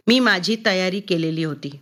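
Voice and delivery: ordinary delivery, nothing marked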